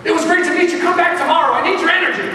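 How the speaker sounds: imitating an excited cashier, high and loud; excited call-out. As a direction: call eagerly after a departing customer. Acting cashier calling after him.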